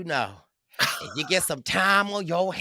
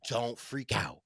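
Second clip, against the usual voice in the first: soft and low, mock-confidential; hushed warning; 5 semitones lower, 9 dB quieter than usual. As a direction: retell a friend's quiet heads-up. Retold friends hushing him in confidence.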